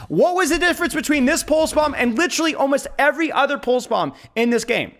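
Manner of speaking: real excitement, pushing the point home; excited. Real, not acted. Excited coaching over a play.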